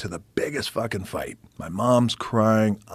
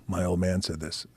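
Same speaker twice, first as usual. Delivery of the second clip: ordinary talk, nothing marked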